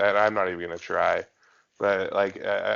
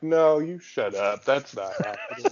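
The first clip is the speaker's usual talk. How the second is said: exaggerated scoffing denial; mock disbelief; 6 semitones higher than usual. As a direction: deny it in a higher, stretched voice. Acting out his disbelief at the news.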